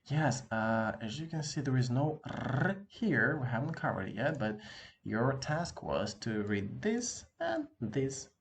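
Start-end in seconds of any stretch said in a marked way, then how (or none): 1.6-3.4 slow emphasis. Shown as a demonstration. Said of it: "teacher stressing a point". 5.0-8.3 slow instruction. Shown as a demonstration. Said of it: "teacher explaining the task".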